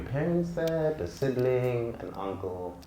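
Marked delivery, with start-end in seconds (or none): none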